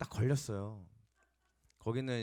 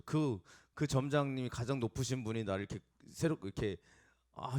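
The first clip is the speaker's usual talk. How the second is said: ordinary talk, nothing marked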